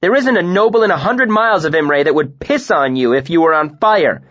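very loud, high furious rant; sustained shouted scorn; furious tirade. A narrator's reading. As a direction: tear him down.

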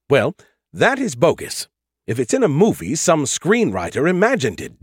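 ordinary delivery, nothing marked

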